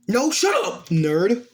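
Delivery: high-pitched indignant comeback; heated retort. Acted. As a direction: shut them down with a high, defensive burst. Acted friends' quarrel, rival snaps back.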